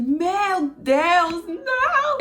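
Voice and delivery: high shocked scream of no; desperate high wail of não; horrified cry. Retold or imitated. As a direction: cry out in horror at the blood. Sees blood on boyfriend.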